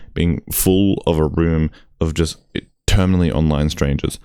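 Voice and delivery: ordinary delivery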